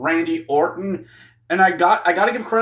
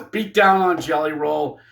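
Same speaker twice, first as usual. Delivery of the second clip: ordinary talk, nothing marked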